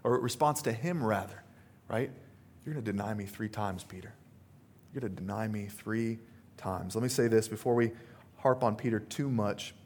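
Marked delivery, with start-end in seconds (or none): 2.6-6.9 quiet solemn Jesus. Retold or imitated. Jesus foretells Peter's denial.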